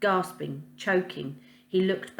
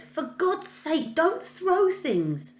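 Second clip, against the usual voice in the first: put-on exasperated girl; 11 semitones higher, much slower than usual. A girl in a bush scolding Fred for throwing a rock, exasperated. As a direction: scold him like a cross young girl. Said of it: "It was a girl's voice".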